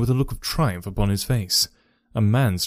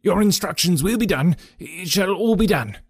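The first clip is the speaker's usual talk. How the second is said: high, anxious eager promises; cringing; 7 semitones higher than usual. A narrator's reading. Cowed trainer promises Holmes obedience, high and fearful. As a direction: grovel to your new master. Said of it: "cringed along ... like a dog".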